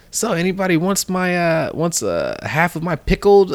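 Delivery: high, put-on kid voice; imitated hopeful offer. Randall offers lunch to the gang, imitated hopeful high voice. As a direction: offer it up high, eager to fit in.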